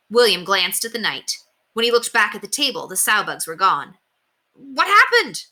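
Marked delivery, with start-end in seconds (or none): 4.5-5.5 put-on high, loud boyish cry of alarm; startled cry. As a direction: blurt it out, caught off guard. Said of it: "he cried".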